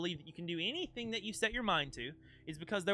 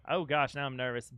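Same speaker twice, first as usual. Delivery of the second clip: ordinary talk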